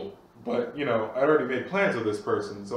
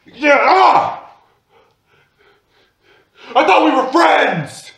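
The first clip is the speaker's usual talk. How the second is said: much higher and louder mock scream; 12 semitones higher, 10 dB louder, slower than usual. Friend running at Zach, hammed-up furious scream. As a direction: charge in yelling betrayal.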